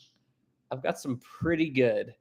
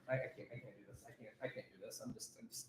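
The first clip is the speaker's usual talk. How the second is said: muttering, much softer, defeated; giving up; 4 semitones lower, 25 dB quieter, much faster than usual. Real, not acted. Streamer overwhelmed by the ship model mistake, soft defeated mutter. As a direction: trail off into a soft defeated mumble.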